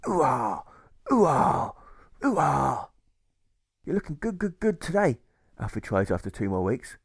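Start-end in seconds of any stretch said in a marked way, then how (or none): 0.1-2.9 hammed-up pirate ooh-ahs; pirate grunts. 3.8-5.2 cheerful flattery. Acted.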